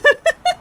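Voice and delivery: loud, high-pitched giggle burst; high laugh. Real, not acted. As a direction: crack up at your own doom. Laughs at his doomed run.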